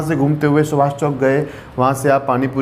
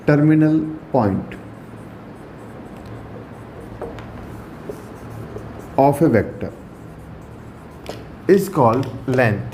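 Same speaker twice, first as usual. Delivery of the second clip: very slow, words spaced out with long pauses; slow dictation; 4 dB quieter, much slower than usual. Shown as a demonstration. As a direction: dictate the definition so students can write it. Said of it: "teacher dictating a definition".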